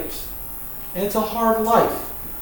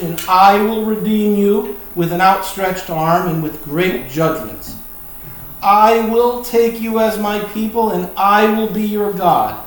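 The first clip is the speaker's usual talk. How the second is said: loud, raised proclamation of God's promises; scripture reading; 3 semitones higher, 3 dB louder than usual. Acted. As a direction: read it out firmly, leaning on each promise.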